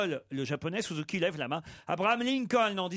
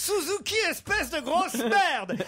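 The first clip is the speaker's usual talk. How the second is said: high, slow shouted insult; pupil yelling; 11 semitones higher, slower than usual. Acted. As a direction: hurl it at Suzuki, high and drawn out. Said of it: "lui hurle alors".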